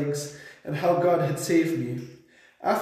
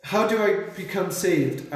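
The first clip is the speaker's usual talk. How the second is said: ordinary delivery, nothing marked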